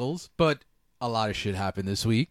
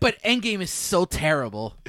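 pretentious nerd voice; 6 semitones higher, 4 dB louder than usual. Retold or imitated. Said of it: "pretentious nerd voice".

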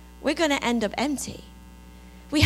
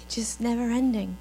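low, measured worship reflection; hushed, unhurried devotional voice; reflective devotional; 5 semitones lower, 10 dB quieter than usual. Real, not acted. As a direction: guide them gently into calm, leaving long pauses. Guides congregation in reflection.

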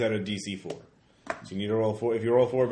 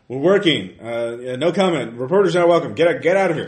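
loud, raised-pitch brush-off; brusque refusal; 4 semitones higher, 10 dB louder than usual. Acted. Worker refusing to talk to a reporter, loud brush-off. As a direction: order an intruder out, firmly and loudly.

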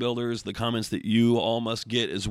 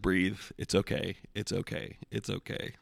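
soft calming voice fading into a whisper; soothing hush; 3 semitones lower, 8 dB quieter than usual. Retold or imitated. Wife calming her livid husband at the table, softly fading to a whisper. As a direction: hush him gently, sinking to a whisper.

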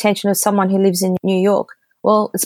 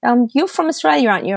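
much higher, lightly mocking greeting voice; a breezy high-pitched impression of a friendly mentor; imitating Paris; 8 semitones higher than usual. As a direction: pop up high like a cheery mentor saying hi. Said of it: "He goes".

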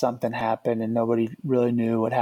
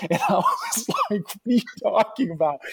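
high-pitched, laughing disbelief; laughing while talking; 8 semitones higher than usual. Real, not acted. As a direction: barely get it out through laughter. Laughs while retelling the scare.

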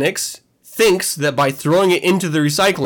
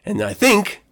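ordinary talk

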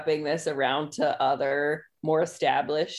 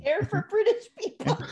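high-pitched mocking British echo; mock British accent; 13 semitones higher, faster than usual. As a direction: parrot it back in a silly British accent. Mockingly echoes the other speaker.

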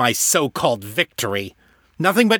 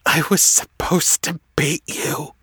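tearful, pitched higher, breaking; grieving rival, tearful and higher; voice thick with tears; 10 semitones higher than usual. Acted. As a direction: mourn him through tears. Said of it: "his voice thick with tears".

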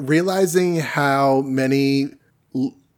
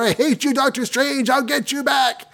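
much higher, strained villain voice; villain's cry; 11 semitones higher than usual. Retold or imitated. Baron Mordo cursing Doctor Strange through the door, high villain voice. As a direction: squeal it like a caged villain.

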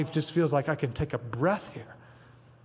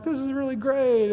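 mock cheerful; 9 semitones higher than usual. Preacher parodies a cheery take on Sabbath, mock sweet. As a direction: sell "gift of rest" with fake sweetness. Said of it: "and, like".

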